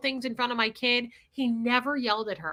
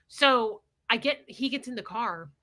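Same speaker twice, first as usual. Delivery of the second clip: normal talking voice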